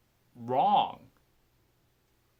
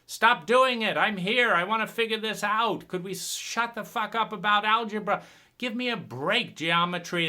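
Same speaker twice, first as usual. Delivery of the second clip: louder, higher furious demand; angry outburst; 6 semitones higher, 4 dB louder than usual. Retold or imitated. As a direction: yell at the grown-ups to stop. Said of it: "It's like".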